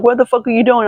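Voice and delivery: high-pitched indignant question; retold angry challenge. Retelling a confrontation at the store, high indignant challenge. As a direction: pitch it up, imitating outrage.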